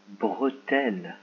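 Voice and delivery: drawn out slowly; slow dictation. Shown as a demonstration. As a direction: stretch every syllable. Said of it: "C'est parti".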